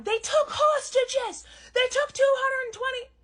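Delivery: mocking outraged people. Comic mocking critics who cry about hostages, high slow wail. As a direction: parody the panicked critics. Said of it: "people are going".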